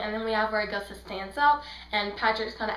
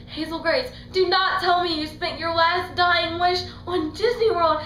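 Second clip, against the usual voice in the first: higher, louder mock outrage; exasperated Augustus; 6 semitones higher, 5 dB louder than usual. Augustus scolding Hazel over her wish, mock outraged. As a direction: raise it into disbelief.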